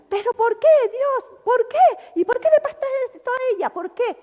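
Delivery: high, loud, fast anguished why; angry grief. Retold or imitated. Man whose partner is ill, imitated railing at God. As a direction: rail at God for her illness. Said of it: "todo, pero enfadado con Dios".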